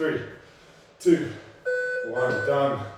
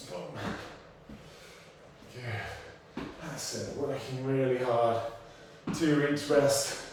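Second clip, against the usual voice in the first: usual speaking voice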